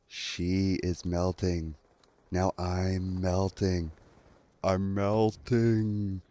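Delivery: very slow, drawn-out, spaced-out words; dazed tripping voice. Retold or imitated. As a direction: imitate yourself lost mid-trip, dragging each word. Retelling his tripping words at window.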